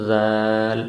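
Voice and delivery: زال said thin, tongue tip between the front teeth; light thin z in زال with the tongue out; thin Zaal. Shown as a demonstration. Teacher demonstrates thin زال. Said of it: "اس کو باریک پڑھا جاتا ہے".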